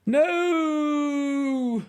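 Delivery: high, drawn-out cry falling away; cry of dismay. Acted. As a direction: wail as you fall in. Man falling into sea.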